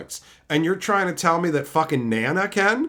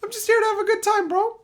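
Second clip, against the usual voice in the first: high, fast chatter voice; faster than usual. Retold or imitated. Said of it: "reading a chatter's comment aloud".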